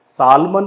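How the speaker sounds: सालमन with long ah and sounded l, "SAAL-man"; wrong pronunciation. Shown as a demonstration. Teacher shows what not to say, wrong pronunciation. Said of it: "नहीं बोला जाता है".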